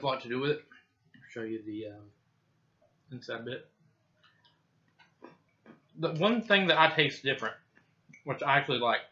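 chewing while talking; talking through a bite of cookie; mouth full. Real, not acted. Seen 1: He shows a bitten cookie, bites it, then talks chewing.

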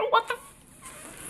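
a real breathy whisper of shock; startled whisper. Real, not acted. Spooked by a creepy toy in the box, startled whisper. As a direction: gasp the words out under your breath.

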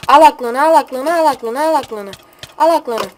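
yüksek sesle, yukarıdan haykır; sataşan bağırış. Real, not acted. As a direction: rakibe meydan okuyarak bağır. (alaycı haykırış)

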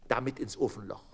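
ordinary delivery, nothing marked

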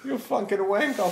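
high mock-British insult; British cursing. Brit cursing somebody out, high British accent. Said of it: "heard a British guy curse somebody out".

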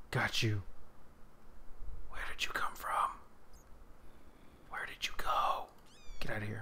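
hushed, playful whispering; sneaky whisper. Real, not acted. Chasing a mob in the dark, sneaky whisper. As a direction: whisper like stalking prey.

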